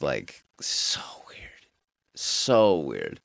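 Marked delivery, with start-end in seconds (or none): 0.0-1.7 hushed, slow whisper. Real, not acted. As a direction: breathe it out slowly. Whispered disgust.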